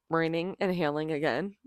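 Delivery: normal talking voice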